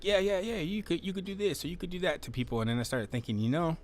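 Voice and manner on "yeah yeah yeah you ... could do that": breezy brush-off. Retold or imitated. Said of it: "I used to just be like".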